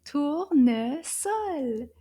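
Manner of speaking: Tournesol very slow, three separate beats; syllable by syllable. Shown as a demonstration. Teacher confirming three syllables, Tournesol slowly split up. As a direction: stretch and count each syllable of Tournesol. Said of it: "If you said trois".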